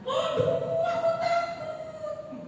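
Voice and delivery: high, slow mock scared wail; mock fearful whine. Pastor imitating a scared believer, high and slow. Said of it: "tidak akan berkata begitu".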